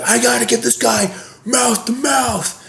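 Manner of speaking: high, slow, laughing mock urgency; over-the-top fake lifeguard; hammed-up rescuer. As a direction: ham up the heroic rescuer through a laugh. Brother acting in CPR bit.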